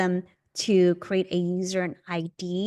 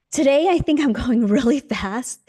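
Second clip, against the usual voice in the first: too much caffeine; 4 semitones higher, 5 dB louder than usual. Real, not acted. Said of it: "I've had two cups of coffee already".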